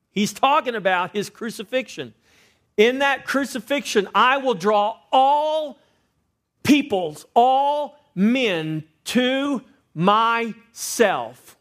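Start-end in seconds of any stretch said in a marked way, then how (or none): 2.8-11.6 high, loud and very slow; preached scripture. Acted. Pastor proclaiming the verse to the church, high and drawn out. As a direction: stretch each word out like a proclamation.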